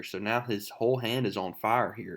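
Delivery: ordinary talk, nothing marked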